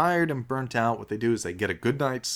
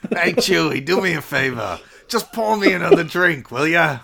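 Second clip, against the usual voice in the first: mock old Han Solo; 5 semitones higher, 4 dB louder than usual. Parody of old Han drunk.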